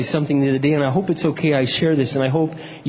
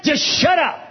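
high, shouted plea; emphatic exhortation; 10 semitones higher, slower than usual. Retold or imitated. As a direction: cry it out high, exasperated advice. Same advice repeated, forcefully acted.

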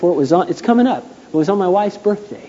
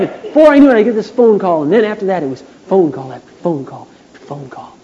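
ordinary delivery

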